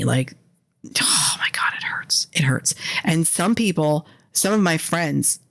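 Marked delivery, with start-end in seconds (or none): 0.8-2.7 anguished mock whisper; hammed-up whispered agony; pained whisper. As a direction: whisper it as if the accent hurts. Pained reaction to local accent.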